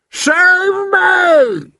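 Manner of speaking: very high, loud giant's shriek; terrified scream. A narrator's reading. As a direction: scream out to be saved. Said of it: "screamed the flesh lump eater".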